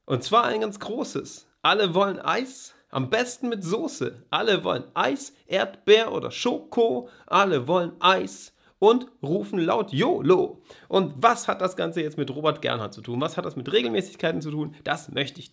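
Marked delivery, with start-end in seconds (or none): 0.1-10.6 poem recital. Shown as a demonstration. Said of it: "Na, nochmal".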